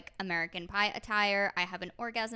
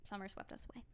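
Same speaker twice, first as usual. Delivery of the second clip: whispering; 17 dB quieter, faster than usual. Pretend. Dramatic aside.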